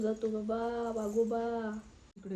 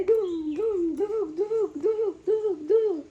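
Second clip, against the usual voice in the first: high, loud sound effects of beating; thumping sounds; 9 semitones higher, 6 dB louder than usual. Acted. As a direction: bang out each thump for the story. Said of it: "कसे मारलं?". (धुम धुबुक असे जोरात आवाज)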